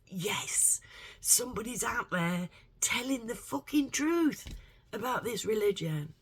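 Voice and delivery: high-pitched, thrilled declaration; elated relief, voice lifting; excited. Retold or imitated. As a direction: burst out with it, rising in pitch. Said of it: "he has excited me".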